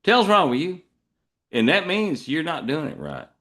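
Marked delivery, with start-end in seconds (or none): 0.0-0.8 put-on higher-pitched old Texas farmer scolding; Texas rancher. Farmer confronting a kid who got it wrong, Texas rancher scolding. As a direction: run it together as a folksy reprimand. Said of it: "old farmer would come in".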